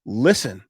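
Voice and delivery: a firm one-word command before the point; emphatic listen. Shown as a demonstration. Revealing the sales secret to listeners, a firm emphatic word. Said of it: "and here's the secret".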